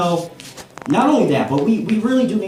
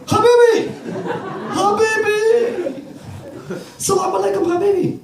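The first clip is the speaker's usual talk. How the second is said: excited Habibi greeting. Retold or imitated. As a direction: greet him like a long-lost brother.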